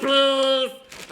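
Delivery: high, loud jingle shout; ad catchphrase call. Pretend. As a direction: belt it like an old TV ad. Chanting the old ad catchphrase.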